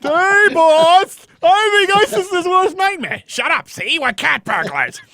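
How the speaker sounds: high, loud, slow cartoon goon; a gangster flunky from a cartoon; dim henchman. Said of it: "a goon in the Flintstones".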